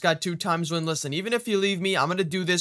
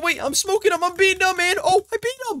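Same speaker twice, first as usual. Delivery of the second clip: high, rising victory cries; excited triumph; 11 semitones higher, 4 dB louder than usual. Real, not acted. Player beating the champion tells his brother, excited triumph. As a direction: build to a high, thrilled yell. Winning fight against champion.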